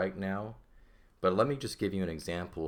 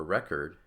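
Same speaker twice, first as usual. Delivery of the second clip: "record" as a noun, first syllable stressed; 4 dB louder than usual. Shown as a demonstration. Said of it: "So we put the accent here".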